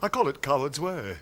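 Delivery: lightly voiced plummy wit; a hint of a theatrical dandy; Noel Coward. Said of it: "Noel Coward".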